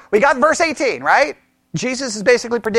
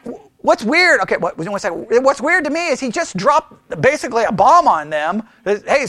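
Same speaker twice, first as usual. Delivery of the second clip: ordinary delivery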